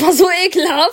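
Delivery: laughing while grossed out; laughing disgust. Real, not acted. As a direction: say it through laughter. Laughing about disgusting classmate. (lachend angeekelt)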